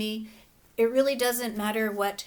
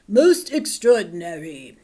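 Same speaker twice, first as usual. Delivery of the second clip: louder, lower amazed doctor; exclaimed; 3 semitones lower, 8 dB louder than usual. Acted. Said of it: "he exclaimed".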